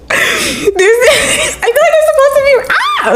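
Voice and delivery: high squeal through real laughter; helpless laughing. Real, not acted. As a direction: try to talk while cracking up. Breaks into laughter at the scene.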